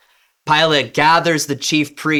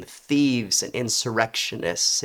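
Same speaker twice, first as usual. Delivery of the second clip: plain reading voice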